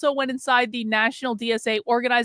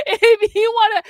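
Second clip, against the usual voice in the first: laughing; 11 semitones higher, 7 dB louder than usual. Real, not acted. Host cracking up during her Substack plug, laughing.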